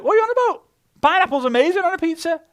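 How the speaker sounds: squeaky high disbelief, quick; high pitched, outraged that anyone doubts it; incredulous high voice. Retold or imitated. The other half of the table, high pitched and incredulous. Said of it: "the other half of the table are like".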